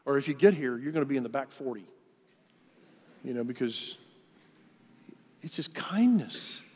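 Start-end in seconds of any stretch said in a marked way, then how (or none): 5.4-6.6 slow, high whisper on kindness. Real, not acted. Stresses God's kindness, preaching.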